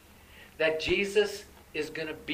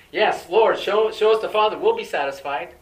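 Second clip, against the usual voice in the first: loud, high pleading cry; eager plea; 4 semitones higher, 11 dB louder than usual. Acted. Philip begging Jesus to show the Father, loud and high. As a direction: beg loudly to be shown. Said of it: "Philip says".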